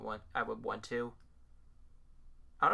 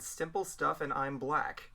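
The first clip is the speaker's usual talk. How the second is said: slow repeat; 3 semitones higher, slower than usual. Shown as a demonstration. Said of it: "Um, I said".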